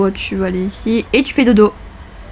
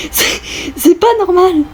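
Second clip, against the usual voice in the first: shrill furious little voice; high angry character voice; 7 semitones higher than usual. Acted. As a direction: rage at the one who broke everything. (petite voix furieuse)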